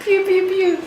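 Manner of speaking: high laughing pew sounds; mock gunfire. Kid acting out the shooting, playful gunfire. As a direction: rattle off playful gunshots. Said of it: "sound effect".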